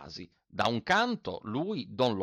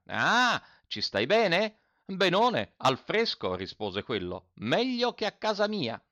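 a hint of gloating in a raised voice; irascible landowner, taunting; 6 semitones higher than usual. A narrator's reading. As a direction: call down a mocking question. Don Lollò taunts man in jar.

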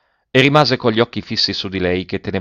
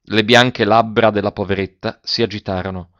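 usual speaking voice

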